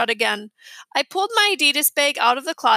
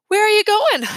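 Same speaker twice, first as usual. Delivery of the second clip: startled question; 9 semitones higher, 7 dB louder than usual. A narrator's reading. Mom asks leaving son.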